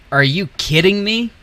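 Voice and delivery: high, loud disbelieving outcry; are you kidding me, yelled up high; exasperated outburst. Real, not acted. Plane blocked by ground traffic.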